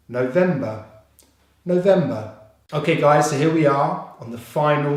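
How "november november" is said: "November" as one word, VEM punched; stress on second syllable. Shown as a demonstration. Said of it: "the stress is on the second syllable".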